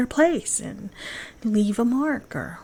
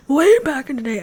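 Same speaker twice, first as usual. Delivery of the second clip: raised, loud old-timer impression; old man's voice; 6 semitones higher, 7 dB louder than usual. Vlogger recalling old running days, old man's voice. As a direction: put on an old man reminiscing, high and loud. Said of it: "that's my old, old man's voice".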